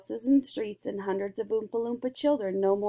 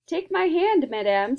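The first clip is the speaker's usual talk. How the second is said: happily; 7 semitones higher, 5 dB louder than usual. A narrator's reading.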